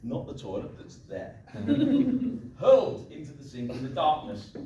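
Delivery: usual speaking voice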